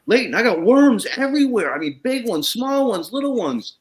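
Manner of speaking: high, excited retelling of a panicked farmer. Retold or imitated. Farmer calling Leighton about worms, high and excited. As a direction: squeak it out like the worms are everywhere. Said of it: "He goes".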